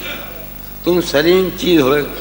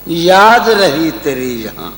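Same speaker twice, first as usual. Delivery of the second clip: tender romantic poetry. Acted. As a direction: recite it lovingly, slowing down. Recites ghazal couplets.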